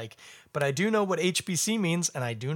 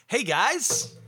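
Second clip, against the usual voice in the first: excited greeting; 8 semitones higher, 5 dB louder than usual. Real, not acted. Vlog greeting, called out.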